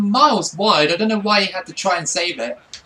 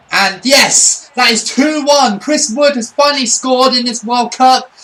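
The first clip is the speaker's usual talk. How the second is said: loud, higher celebration of the goal; excited goal call; 5 semitones higher, 5 dB louder than usual. Real, not acted. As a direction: cheer it like a commentator. Team scores again.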